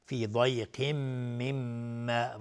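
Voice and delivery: slow recitation, ضیق merging into a long hummed meem in مما; merging with ghunna. Shown as a demonstration. Teacher showing the two-count ghunna, doubled meem of مما hummed long. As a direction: hold a nasal hum from ضیق into مما.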